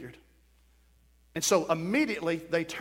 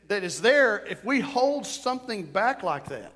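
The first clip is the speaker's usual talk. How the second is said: normal talking voice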